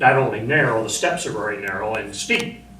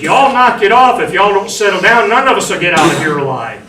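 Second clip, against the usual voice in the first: much higher, loud commanding scream; 7 semitones higher, 9 dB louder than usual. Retold or imitated. Marine screaming at panicking sailors to settle down. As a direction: scream to silence the panicking crowd. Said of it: "And I just screamed".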